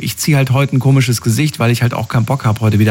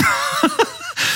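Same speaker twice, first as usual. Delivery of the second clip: high squealing laugh; 6 dB quieter than usual. Real, not acted. Laughs after the quote.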